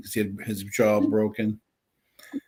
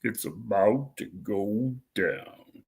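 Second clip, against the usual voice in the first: deepest voice; 6 dB quieter, much slower than usual. Shown as a demonstration. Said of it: "say in your deepest voice possible".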